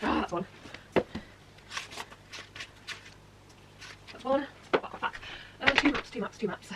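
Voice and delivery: distant, off-mic murmuring; talking to herself across the room; far from mic. Real, not acted. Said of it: "I'm hoping you can hear me".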